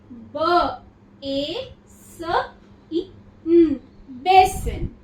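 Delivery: each sound separated, then बेसिन blended slowly and loud; sounding out syllables. Shown as a demonstration. Teacher to students, sounding out बेसिन syllable by syllable. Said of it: "तो अब इसको कैसे पढ़ेंगे".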